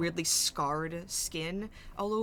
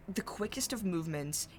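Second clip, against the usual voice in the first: ordinary talk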